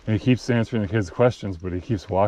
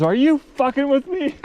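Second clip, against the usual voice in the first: very high, loud, slow disbelieving question; suspicious Billy Bob; 5 dB louder than usual. Retold or imitated. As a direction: stop short and squint at the kid. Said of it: "cuts himself off mid-sentence and goes".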